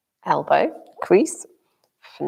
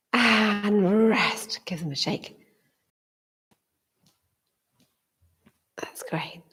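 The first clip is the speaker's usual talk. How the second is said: whispering; 3 semitones higher, slower than usual. Real, not acted. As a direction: whisper gently, barely voiced. Teacher cues rest quietly.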